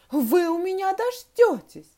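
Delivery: high, loud teacher's warning; angry threat. A narrator's reading. Teacher threatening misbehaving pupils, angry and high. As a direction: warn them sharply, pitched right up. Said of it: "рассердилась Глафира Петровна".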